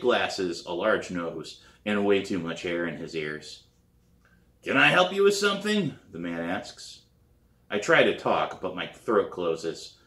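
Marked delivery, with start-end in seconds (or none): 4.6-6.0 high, louder, curious man at the door; a polite but odd welcome; stranger at the door. Acted.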